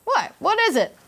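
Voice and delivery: surprised high "what"; groggy surprise. Retold or imitated. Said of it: "I was like".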